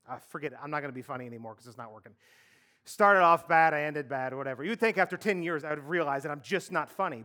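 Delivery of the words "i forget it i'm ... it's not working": quick defeated mumble. Real, not acted. Gives up on the joke.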